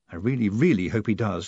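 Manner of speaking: ordinary delivery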